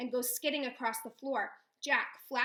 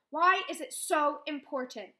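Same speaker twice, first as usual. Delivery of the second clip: louder, a little higher; angry demand; 4 semitones higher, 8 dB louder than usual. A narrator's reading. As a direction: challenge her. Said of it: "flushing with anger".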